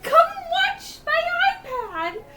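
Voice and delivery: put-on high, slow whiny child voice; high, slow whiny toddler begging her to watch; whiny little girl. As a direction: plead like a small girl.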